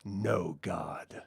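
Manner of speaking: low, soft, otherworldly vampire voice; vampire dismissing her last hope, low and soft; cold vampire reply. Retold or imitated. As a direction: answer flatly from above, dropping low. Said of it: "he looks up and he's like".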